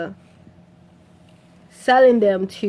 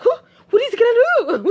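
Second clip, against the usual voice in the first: high, laughing incredulous question; retold high-pitched giggly astonishment; amused disbelief; 14 semitones higher, 4 dB louder than usual. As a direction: squeal it through a laugh. Her amused reaction to the scene.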